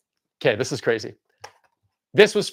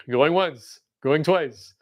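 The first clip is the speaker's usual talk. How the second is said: auctioneer; 5 semitones lower, much slower than usual. Pretend. Auction call.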